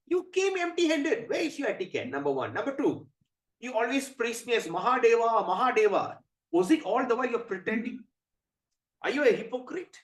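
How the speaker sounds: high, loud mock outrage; angry tirade. Shiva berating empty-handed Narada, angry. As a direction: berate him, counting off the offences. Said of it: "Lord Shiva, you're very angry".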